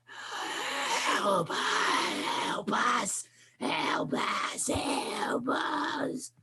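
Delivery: breathy, whispered monster cry for help; a flesh creature wheezing out a drawn-out plea; creature's plea. Acted. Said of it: "a mouth opens".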